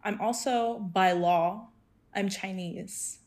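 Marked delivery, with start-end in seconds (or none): none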